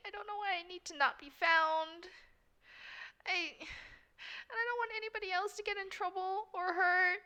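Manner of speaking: high-pitched, halting upset voice; acted tearful distress. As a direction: pour out worries through pretend tears. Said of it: "she just cries for a bit".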